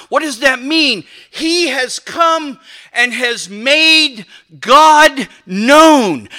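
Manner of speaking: emphatic sermon climax, hammering key words; forceful preaching. Real, not acted. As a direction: proclaim it with rising conviction.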